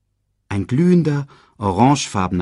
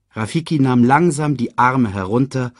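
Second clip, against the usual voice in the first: ordinary delivery, nothing marked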